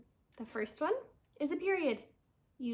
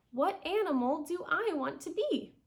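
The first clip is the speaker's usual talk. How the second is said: "be" lifted high at the end as a question; questioning rise; 4 semitones higher than usual. Shown as a demonstration. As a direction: ask it, raising the voice on "be". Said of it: "raise their voice at the end".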